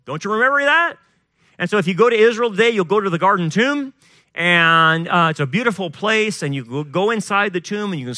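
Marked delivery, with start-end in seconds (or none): none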